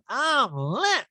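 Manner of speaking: very high, squeaky mock drunk voice; squeaky Erica imitation. Host mocking a tipsy castmate, very high and squeaky. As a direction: squeak it like a tipsy reality star.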